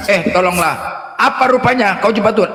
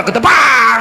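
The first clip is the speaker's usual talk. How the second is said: punch sound effect; 3 semitones higher, much slower than usual. Retold or imitated.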